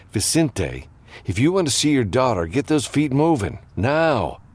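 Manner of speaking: urgent command. A narrator's reading. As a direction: push him to get moving, fast and high-pitched. Hero snaps man out of shock.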